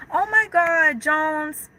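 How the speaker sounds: high-pitched delighted gasp of a name; genuinely touched and thrilled; reading a viewer's apology, overjoyed; excited. Real, not acted. Reacts to the viewer's apology message.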